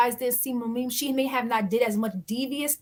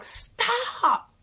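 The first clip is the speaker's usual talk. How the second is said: high stop; 10 semitones higher than usual. Retold or imitated. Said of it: "The producers had to grab her like".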